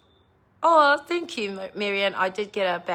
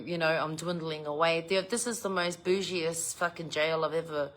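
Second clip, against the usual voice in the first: normal talking voice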